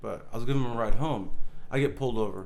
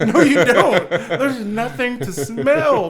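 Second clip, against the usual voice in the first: high, loud and laughing, stretched out slow; laughing protest; 9 semitones higher, 10 dB louder, much slower than usual. Retold or imitated. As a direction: protest while cracking up. Retelling arguing with cop.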